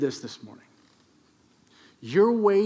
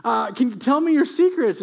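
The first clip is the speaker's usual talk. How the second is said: higher, louder amazed praise; gushing boss; 8 semitones higher, 5 dB louder than usual. Acted. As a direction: rave like a thrilled manager. Boss raves about Obed's performance.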